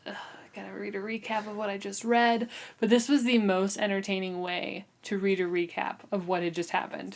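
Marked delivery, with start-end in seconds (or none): 0.1-2.5 unvoiced grumbling whisper; weary whisper. Reader groaning to herself about the recap, whispered. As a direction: sigh it out under your breath, fed up. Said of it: "you're like".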